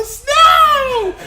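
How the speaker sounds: put-on high, loud, childlike shriek of joy; screaming like a child. A grown man spots snow at the airport, screaming with childlike glee. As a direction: squeal it like a kid seeing snow for the first time. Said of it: "I'm screaming snow like a child".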